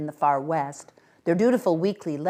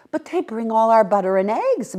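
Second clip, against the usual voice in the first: appalled; 6 semitones higher, 7 dB louder than usual. A narrator's reading.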